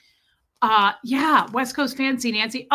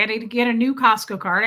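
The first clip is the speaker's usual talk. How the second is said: ordinary delivery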